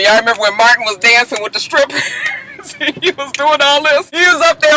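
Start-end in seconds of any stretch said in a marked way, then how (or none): 2.0-2.7 high squealing laugh. Real, not acted. Vlogger cracking up at [name] dancing, high squeal laugh.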